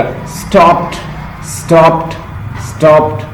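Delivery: "اسٹاپڈ" ending in a crisp T, not D; high, separate repeats of "اسٹاپڈ" as "stopt"; teacher showing "اسٹاپڈ" with a T ending; final T sound. Shown as a demonstration. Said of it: "ٹھا کی sound غور سے دیکھیے گا".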